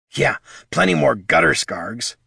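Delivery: lightly grumbled complaint. A narrator's reading. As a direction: grumble it under the breath. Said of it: "grumbled Bert".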